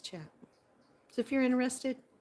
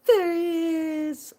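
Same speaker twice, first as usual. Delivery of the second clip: high, loud, drawn-out hail; excited greeting; 10 semitones higher, 9 dB louder, slower than usual. Real, not acted. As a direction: call it out big, high and long. Hails someone arriving in live chat.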